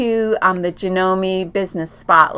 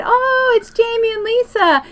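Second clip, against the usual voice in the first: much higher, bright mock delight; excited; 3 dB louder than usual. Sister arriving home thinking guests came, excited and high. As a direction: play her thrilled guess at the door. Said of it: "So she's all excited".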